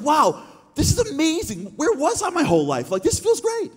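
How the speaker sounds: wide-eyed bedtime bliss, pitched high; giddy amazement. Retold or imitated. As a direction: float up high on "Wow" and stay up there, marvelling. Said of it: "you're like".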